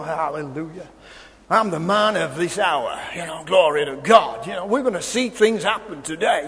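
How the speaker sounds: low, swaggering man-of-God voice; hammed-up holy confidence; mock confident preacher. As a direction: send up a self-assured revivalist, pitched low. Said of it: "we all wanna walk in confident".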